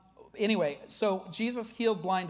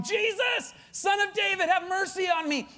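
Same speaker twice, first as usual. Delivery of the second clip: high, loud cry for mercy; shouted plea, pitched way up; acted yelling; 11 semitones higher, 4 dB louder than usual. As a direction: call out desperately as the blind beggar. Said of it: "he yells all the louder".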